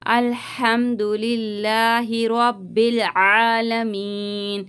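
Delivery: very slow recitation, मीन held before stopping; tajweed recitation. Shown as a demonstration. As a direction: recite अल्हम्दुलिल्लाहि रब्बिल आलमीन, lengthening आलमीन. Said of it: "खींचते हुए रुकेंगे". (आलमीन को खींचते हुए धीमी तिलावत)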